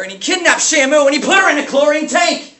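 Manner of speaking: high, loud outraged yell; raging outburst. Acted. Substitute teacher venting about the man to school children, raging yell. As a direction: build the rant into a high-pitched holler. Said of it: "lashing out his rage".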